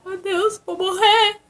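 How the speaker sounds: high, crying wail; mock tearful panic, voice cracking high; panicked crying. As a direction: choke out the fear through tears. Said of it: "Pensei assim".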